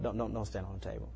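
put-on gently but firmly tell the toddler no; a quiet parent setting a limit; calm firm correction. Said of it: "two-year-old".

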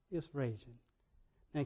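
ordinary talk, nothing marked

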